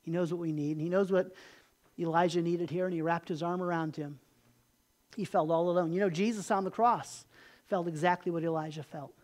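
normal talking voice

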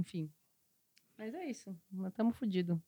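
very soft resigned fade; trailing off. Real, not acted. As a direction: let it die away.

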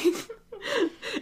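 high, soft clown squeal; squeaky goofy clown giggle; silly clown noise. Pretend. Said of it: "Your silly clown noises aren't helping".

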